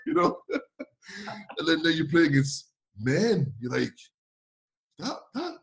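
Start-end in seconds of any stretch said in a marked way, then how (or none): 5.0-5.6 gawk at the muscles in alarm; higher, wide-eyed worry; panicked kid voice. Retold or imitated. Panicked teen facing men.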